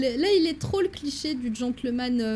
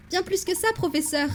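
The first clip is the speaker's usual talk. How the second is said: higher, lively assistant's voice; eager young assistant; 7 semitones higher than usual. Acted. Assistant answers the professor, higher eager voice. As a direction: answer the professor brightly, pitched up.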